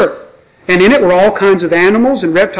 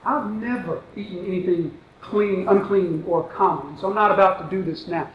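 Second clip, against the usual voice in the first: softer, lofty mock of Peter's refusal; self-righteous Peter; 9 dB quieter than usual. Preacher mimics Peter refusing the Lord, quietly self-righteous. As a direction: sniff at the order like a man too pure for it. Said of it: "You hear the self-righteousness in Peter's voice".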